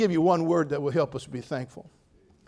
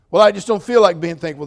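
louder whiny objection; mock complainer; 3 semitones higher, 12 dB louder than usual. Pastor voices a complaining congregant, mock whiny. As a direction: play the reluctant churchgoer.

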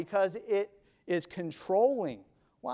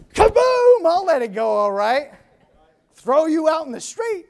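explosive shout; 9 semitones higher, 11 dB louder than usual. Acted. Preacher exclaims and jokes.

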